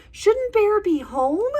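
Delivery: high, slow, urgent hare cry. Acted. Hare warning the friends about the storm, high alarmed cry. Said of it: "cries Hare".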